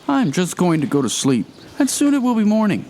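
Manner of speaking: high, tense and nervous; scared. A narrator's reading. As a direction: talk yourself out of panic, pitched high. Frightened man reassures himself.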